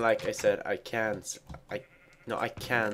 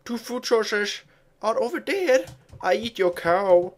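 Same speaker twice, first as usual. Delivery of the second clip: silly high voice; 10 semitones higher, 3 dB louder than usual. Pretend.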